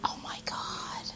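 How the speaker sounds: breathless whispered oh my God; delighted whispered gasp. Real, not acted. Reacts to purple dress.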